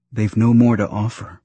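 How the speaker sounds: usual speaking voice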